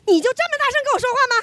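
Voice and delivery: fast, high mock mom, laughing; mother losing the argument, falling back on scolding; mom's scolding. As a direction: rattle off the mom line again. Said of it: "她道理讲不过我".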